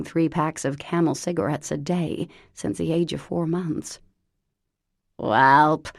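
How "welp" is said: loud, put-on voice of an old chain smoker; Lavinia's horrible voice. Cousin Lavinia phoning Parker, loud put-on smoker's voice. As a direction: bark it out like a lifelong smoker. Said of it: "she said, exhaling".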